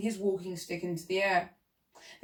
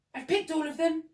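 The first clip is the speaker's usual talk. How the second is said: ordinary delivery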